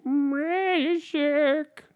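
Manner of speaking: very high, strained caricature voice; drawn-out squeal of a celebrity impression; exaggerated high-pitched mimicry, held long on each word; Ray Romano impression. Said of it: "hey, let me try".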